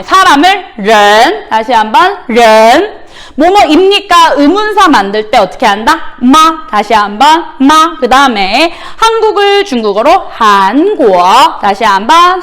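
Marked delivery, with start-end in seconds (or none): none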